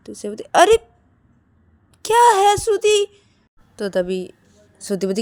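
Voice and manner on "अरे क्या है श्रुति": high, loud exasperated retort; irritated retort. Acted. Siya answering Shruti's scolding, loudly irritated. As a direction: throw it back, annoyed.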